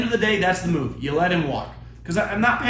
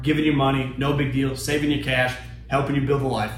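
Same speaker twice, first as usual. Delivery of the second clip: lower, relaxed sales pitch for a loan site; sponsor ad read; 6 semitones lower than usual. Acted.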